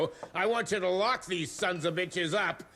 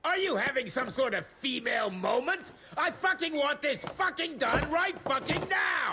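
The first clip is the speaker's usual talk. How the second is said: shrill furious tirade, much higher; furious rant; 10 semitones higher than usual. Acted. Harrow barking at his staff in fury. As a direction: spit out the demand in rising fury.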